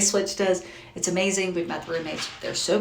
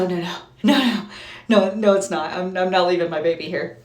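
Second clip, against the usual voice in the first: emphatic refusal; 5 dB louder than usual. Retold or imitated. Mom refusing to leave son.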